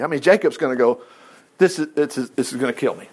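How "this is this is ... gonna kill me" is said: low, sinking voice; understated, defeated groaning; acted despair. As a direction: say it low, as a father giving up. Jacob imagined hearing the news.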